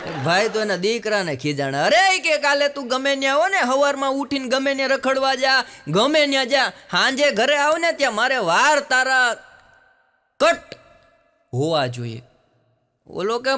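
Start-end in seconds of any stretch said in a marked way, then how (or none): 1.8-12.2 high, loud fatherly scolding. Acted. Father scolding his son about long hair, raised angry voice. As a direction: bark orders like a fed-up dad.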